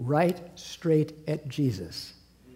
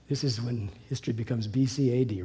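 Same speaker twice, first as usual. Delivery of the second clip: ordinary talk, nothing marked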